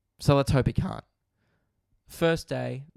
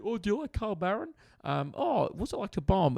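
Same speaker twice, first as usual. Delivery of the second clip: much higher, put-on eager fan questions; mocking stranger voice; 8 semitones higher than usual. Strangers quizzing a comedian, high mock-eager voice. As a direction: parody the usual questions a comic gets.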